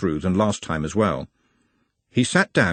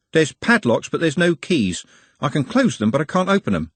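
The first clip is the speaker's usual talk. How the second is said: higher-pitched character voice, lightly put on; a touch of unease, pitched up; Trev figure, uneasy; 7 semitones higher than usual. Trev to Nutt about padlocks with no keys, uneasy.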